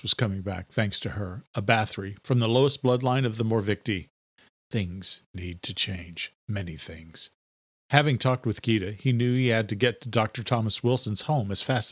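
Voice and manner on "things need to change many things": much softer, lower and slow inner thought; softer brooding inner vow; quiet resolve. A narrator's reading. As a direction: murmur it to yourself.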